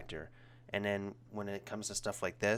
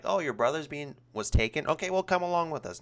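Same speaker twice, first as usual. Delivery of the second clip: higher, louder mock-casual Mario; 8 semitones higher, 5 dB louder than usual. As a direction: toss it off breezily as Mario, pitched up. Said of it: "was like".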